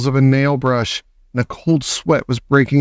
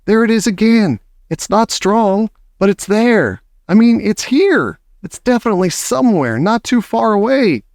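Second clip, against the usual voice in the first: excited witch; 8 semitones higher than usual. A narrator's reading. Witch insisting she smells it.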